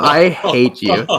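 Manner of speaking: slow, stretched-out "I hate you"; mock hatred. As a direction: drag out each word in playful outrage. Play-angry comeback to the teasing.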